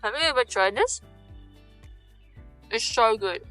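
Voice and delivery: normal talking voice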